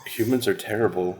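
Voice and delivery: usual speaking voice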